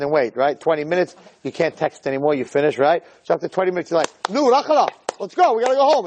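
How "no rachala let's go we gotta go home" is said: high pleading to leave; whiny impatient husband. Retold or imitated. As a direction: whine it like a bored husband.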